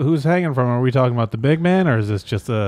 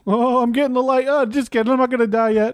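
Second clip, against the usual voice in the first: higher-pitched comic fake-out; milk the dying bit in a raised voice; wisecracking crucified Jesus; 12 semitones higher than usual. Jesus jokes with visitor about dying, high-pitched wisecracking.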